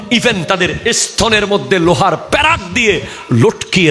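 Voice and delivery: high-pitched fiery sermon delivery; impassioned hellfire preaching; full-force preaching. Real, not acted. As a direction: warn the congregation with rising, ringing intensity.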